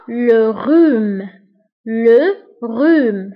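لو روم with no h sound, drawn out slowly; silent h. Shown as a demonstration. Teacher showing class the silent h, slowly. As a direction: rhume said slowly as "rom", h dropped. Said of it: "لو روم يكتب ب اش ولكن لا ننطقها".

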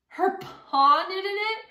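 high, loud, slow mocking disbelief. A narrator's reading. Bandit mocking Jemima's pa, high and slow. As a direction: sneer it in scornful disbelief.

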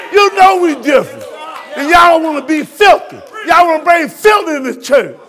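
loud, high rebuking sermon; fervent preacher shout; preaching at full force. Real, not acted. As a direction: scold the church with fire. Preacher rebuking congregation loudly.

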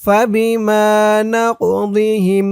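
slow melodic tajweed chant; Quran recitation. Shown as a demonstration. Recites verse. (ধীর সুরেলা কুরআন তিলাওয়াত)

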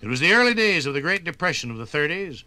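ordinary delivery

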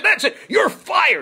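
high, loud hammed-up shout; furious firing. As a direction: blow up and fire him. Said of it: "And I said".

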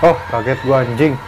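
ordinary talk

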